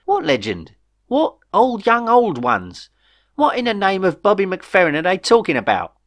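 high-pitched, baffled outburst; incredulous questions, climbing high; exasperated bewilderment. A narrator's reading. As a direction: demand answers, utterly lost for words. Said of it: "he was fighting for adequate phraseology".

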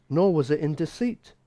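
usual speaking voice

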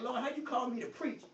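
ordinary talk, nothing marked

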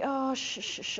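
soothing shush. Retold or imitated. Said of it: "I'm going".

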